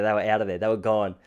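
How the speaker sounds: ordinary talk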